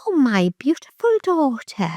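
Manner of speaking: high, put-on posh mother; sweet-talking like a con artist; imitating a fake-sweet posh mum; posh pretentious voice. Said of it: "That's a posh, that's a pretentious voice".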